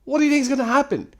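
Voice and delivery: high, fast, incredulous outburst; exasperated scolding. Real, not acted. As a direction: lay into him, pitch way up. Scolds the texter as idiot.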